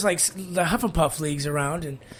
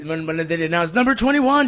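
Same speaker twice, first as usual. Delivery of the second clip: normal talking voice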